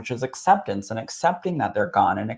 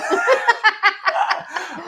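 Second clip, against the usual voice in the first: high loud burst of laughter; 3 dB louder than usual. Real, not acted. Medium laughing at the time joke, high laugh.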